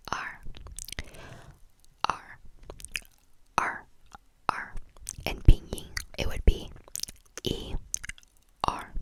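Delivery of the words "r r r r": "R" said as Mandarin èr, an "er" pulled back in the throat, whispered; Chinese er. Shown as a demonstration. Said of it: "do would be".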